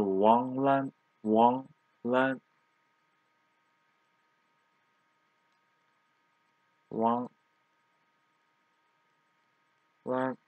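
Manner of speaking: Wang Lan with second tone on both syllables; second-tone demo. Shown as a demonstration. Teacher writing the characters sounds out Wang Lan in second tones. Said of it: "both of them second tone".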